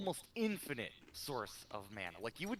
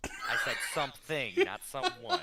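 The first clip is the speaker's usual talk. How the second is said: laughing; 3 semitones higher, 10 dB louder than usual. Real, not acted. Laughing protest.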